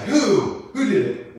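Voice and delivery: high, loud indignant demand; sharp rise of mock outrage; mad king. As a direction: act out an outraged king's questions. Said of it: "And the king is so mad".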